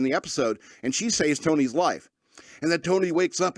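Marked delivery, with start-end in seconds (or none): none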